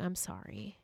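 soft whispered apology; quiet hushed I'm sorry. Retold or imitated. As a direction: breathe it out, barely voiced. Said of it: "he whispers".

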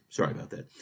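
whispered aside, very soft. Real, not acted. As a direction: breathe the apology under your breath. Host apologizing after reverie.

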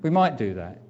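ordinary talk

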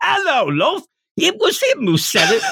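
high, laughing put-on Irish voice; squeaky stage Irish, giggling; Irish accent. Power blaming Denji in a high Irish accent. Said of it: "developing an accent".

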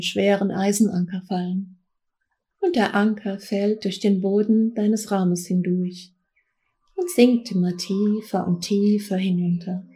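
guided meditation. Acted. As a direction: guide them gently into trance. Leads a guided trance journey.

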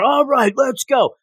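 a playful yell to get going, not full volume; imitated yell. Podcaster imitates the player charging in, mock yelling. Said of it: "You don't have to yell".

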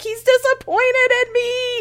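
high, slow, drawn-out comic whine; mock crying. Crying hiker fearing disappointment, mock tearful whine. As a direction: wail it like a tearful child, half laughing. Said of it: "crying even more 'cause I'm like".

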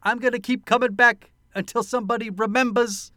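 high, loud Brooklyn tough guy; Brooklyn accent. Retold or imitated. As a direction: threaten in a hammed Brooklyn accent. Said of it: "His accent just got more ridiculous".

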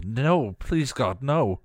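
slow, high-pitched plea; drawn-out, anguished prayer; desperate pleading. A narrator's reading. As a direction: beg in helpless horror. Anguished plea as Dawn is dragged away.

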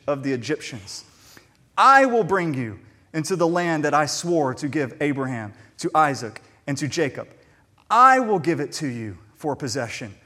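loud proclaiming scripture reading; preacher reading God's words with full force; preaching scripture. Acted. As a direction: proclaim God's promises from the pulpit.

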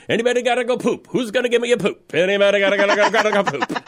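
fast high auctioneer chant. Pretend. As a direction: rattle it off like an auctioneer at the dogs. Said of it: "being like an auctioneer".

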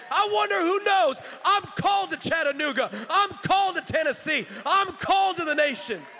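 high, loud sermon climax; full-force preaching. Real, not acted. Preacher calling the congregation to stand, full-force climax. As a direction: cry out each phrase, climbing to a shout.

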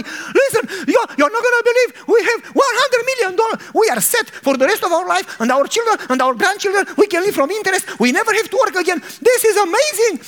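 high, fast, breathless joy; wife phoning family about the money, ecstatic. Acted. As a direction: gush the news to your sister.

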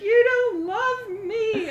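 high, loud, very slow crying whine; mock sobbing. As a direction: drag it out like a tearful wail. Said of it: "you just started crying".

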